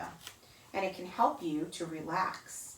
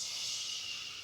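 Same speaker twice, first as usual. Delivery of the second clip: soft whispered shh of the wind; 9 dB quieter than usual. Retold or imitated. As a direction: breathe it like wind in the leaves. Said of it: "It whispers".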